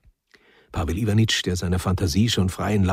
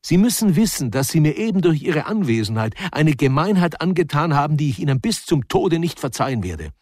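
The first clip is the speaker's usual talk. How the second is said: higher and louder, wounded fury; offended rival vowing never to forgive; indignant retort; 7 semitones higher, 4 dB louder than usual. Acted. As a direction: hurl it back in his face.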